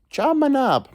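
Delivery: Job my knob jumped way up high, sing-song; silly high-pitched repeat. Shown as a demonstration. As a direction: toss Job my knob up into a high squeaky register. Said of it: "repeated high".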